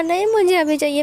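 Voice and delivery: put-on high, loud child's whine; whiny little girl. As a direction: demand it back like a cranky toddler. Child refuses to wait.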